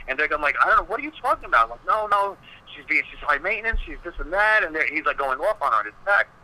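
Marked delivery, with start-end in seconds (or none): 0.6-1.6 high, fast, playing dumb; a quick squeaky denial from a guilty friend; mock innocent friend. Friends voiced feigning innocence. 1.9-4.6 high, whiny griping about her; hammed-up whining from a friend; mock whiny complaint. Friend voiced complaining about Karen.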